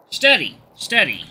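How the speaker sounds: loud high call, then calmer; urgent steadying. Acted. Ink steadying Giant as the blade comes out, urgent. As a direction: hold him still, urgently. Calming during blade pull.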